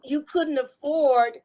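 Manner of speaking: normal talking voice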